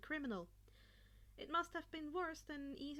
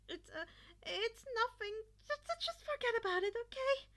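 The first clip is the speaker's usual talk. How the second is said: higher, hurried and louder, panicky; nervous pleading; 10 semitones higher, 7 dB louder than usual. Acted. Izuku begs the bullies to drop it, fast and panicky. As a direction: brush it off in a frightened rush. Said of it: "Izuku replied".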